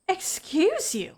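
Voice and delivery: high, drawn-out affronted exclamation; indignant outburst. Acted. Gretchen objecting to being called unsafe, indignant outburst. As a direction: stretch it out, haughtily offended. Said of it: "after that outburst came over me".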